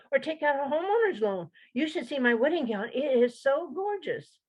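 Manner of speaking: high, fast, bubbly chatter; chirpy bank teller. Acted. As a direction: gush nonstop like an eager teller.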